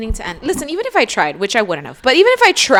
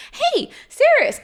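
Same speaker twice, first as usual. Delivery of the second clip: mock sweet Lorith; slower than usual. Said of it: "And he's like".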